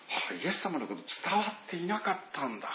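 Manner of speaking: soft, sighing letdown; sighing disappointment. Retold or imitated. Paul grieving over his spiritual children, soft sigh. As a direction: breathe it out in quiet disappointment. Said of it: "そういう思いが".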